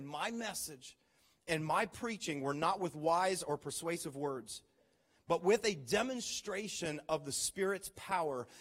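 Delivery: slow, measured reading of the verse; scripture reading. Acted. Pastor reading First Corinthians to the congregation, measured. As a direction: read it out with weight and care. Reads Paul aloud.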